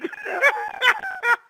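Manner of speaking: high burst of laughter; big laugh. Real, not acted. Laughing at his own story.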